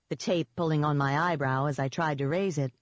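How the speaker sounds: ordinary delivery, nothing marked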